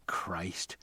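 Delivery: Christ hissed under the breath; a sharp annoyed whisper; whispered curse. A narrator's reading. Robbie swears at locked door.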